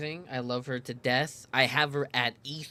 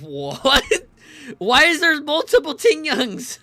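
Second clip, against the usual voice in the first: loud, high bewildered cry; shocked disbelief; 11 semitones higher, 12 dB louder, slower than usual. Real, not acted. Streamer seeing many Ting Youngs, loud shocked cry. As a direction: blurt it out in shock.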